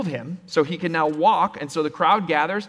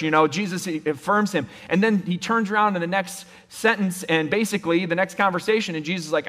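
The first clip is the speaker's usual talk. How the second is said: ordinary talk, nothing marked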